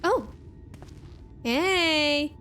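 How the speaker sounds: ordinary talk, nothing marked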